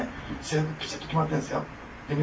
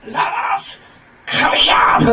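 much higher, loud shouted summons; big shout; 11 dB louder, much slower than usual. Retold or imitated. Imitating the Texas pastor's sermon, a huge shout. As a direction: roar it from the pulpit. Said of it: "すごい大声で".

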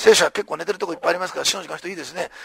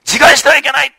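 loud call; 5 semitones higher, 4 dB louder than usual. Retold or imitated. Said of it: "パウロ大声で言います".

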